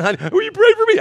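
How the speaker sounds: high sleepy mock wife voice; wife's voice. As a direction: imitate the wife calling him awake at night, pitched high. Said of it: "She'll wake me up at midnight now".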